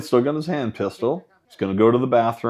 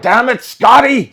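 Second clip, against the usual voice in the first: hammed-up captain's shout, higher and louder; mock Kirk yell; 11 semitones higher, 7 dB louder than usual. Riffing on a film, yelling at the engineer as Kirk. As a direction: bark it like a starship captain in trouble.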